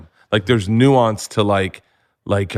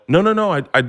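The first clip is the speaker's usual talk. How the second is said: flustered denial; 9 semitones higher, much faster than usual. Retold or imitated. Denies it's a bit.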